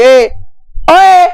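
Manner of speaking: sharp high loud call. Real, not acted. Teacher calling out to the distracted class, loud high shout. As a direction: yell to snap them back to attention.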